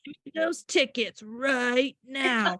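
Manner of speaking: slow, drawn-out demand in a put-on bully voice; Zootopia bully. Mother imitating her son doing the movie bully, slow mock menace. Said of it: "he does the voice exactly like that, the intonation".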